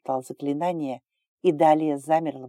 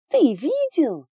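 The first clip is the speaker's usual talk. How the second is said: high loud shriek, drawn out; 12 semitones higher, 8 dB louder, much slower than usual. A narrator's reading. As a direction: shriek it again, longer. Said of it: "визжала Далия".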